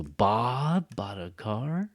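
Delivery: "Bob" hugely stretched, slow questioning rise; slow overdone echo question on "Bob"; exaggerated stress on BOB. Shown as a demonstration. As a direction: draw out "Bob" in disbelief. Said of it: "even more than it's supposed".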